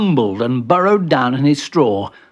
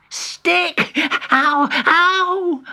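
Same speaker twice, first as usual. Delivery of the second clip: very high, squeaky yelps; squealing fright; much slower than usual. Acted. Benji dreading the stick, high frightened squeal. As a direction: squeak it and yelp in fear.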